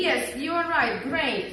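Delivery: ordinary talk, nothing marked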